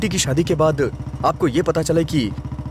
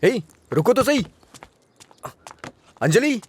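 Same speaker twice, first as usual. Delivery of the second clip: higher-pitched call to stop; urgent, raised-pitch calling out; calling after someone; 6 semitones higher than usual. Acted. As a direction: call out after her as she leaves. Calls after friend walking away.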